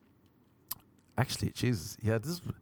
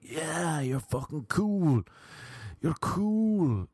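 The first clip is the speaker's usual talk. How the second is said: mocking hype; 5 semitones higher, much slower than usual. Mocking karaoke crowd egging on.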